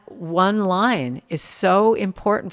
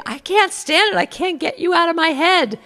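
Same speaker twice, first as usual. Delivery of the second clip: high, whiny mock complaint, pitched way up; mock exasperated fan; 12 semitones higher than usual. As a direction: squeal it like a fan who can't shake the tune. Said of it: "people come up to me and say".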